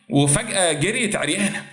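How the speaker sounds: usual speaking voice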